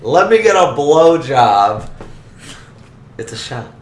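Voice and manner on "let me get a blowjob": loud, slow call across the bar; hollering. Acted. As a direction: shout the order over the crowd. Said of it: "I holler across the bar".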